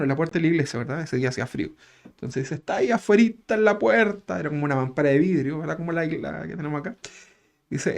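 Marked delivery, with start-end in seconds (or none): 2.6-4.4 tearful visionary. Retold or imitated. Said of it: "con un llanto que le salía como de acá".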